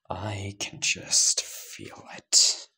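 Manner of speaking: very slow, breathed-out whisper; menacing hushed repeat. Acted. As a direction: savour each word, almost whispering. Line said twice.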